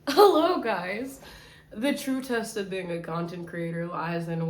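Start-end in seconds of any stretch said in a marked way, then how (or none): none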